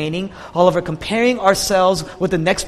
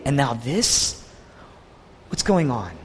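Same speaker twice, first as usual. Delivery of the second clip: dropped, lower bewildered questioning; confused; 5 semitones lower, 5 dB quieter than usual. Retold or imitated. Preacher as Jonah questioning God, confused. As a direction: let the protest sink into bafflement. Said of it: "he's confused".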